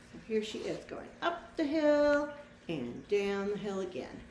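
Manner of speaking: plain reading voice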